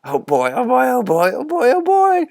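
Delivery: overwhelmed, laughing through tears; weeping with happiness. A narrator's reading. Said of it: "exhausted and weeping with happiness".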